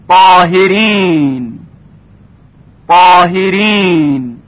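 slow recitation with thick, emphatic ط in شیطان and طاهرین; heavy tafkhim. Shown as a demonstration. Tajwid teacher giving examples of ط, heavy drawn-out tafkhim. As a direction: draw out طاهرین with a heavy ط and dark ā.

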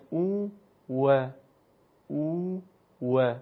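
«او و» drawn out slowly with rounded lips, w not v; Arabic w sound. Shown as a demonstration. Teacher demonstrating the Arabic «و» to students, slow rounded w.

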